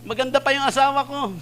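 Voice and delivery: high comic panic; frantic plea. Retold or imitated. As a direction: beg to be spared, half comic. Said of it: "sabi niya".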